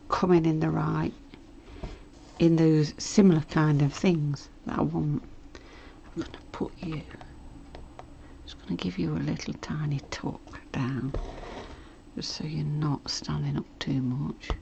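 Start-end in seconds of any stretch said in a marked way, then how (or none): 6.2-14.5 soft whisper to the fabric. Real, not acted. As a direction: murmur gently to the stitches. Whispers while sewing.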